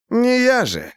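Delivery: bare your teeth and smirk it; grinning smirk. A narrator's reading. Mo grins at Rodrigo's question, smirking. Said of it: "осклабился Мо".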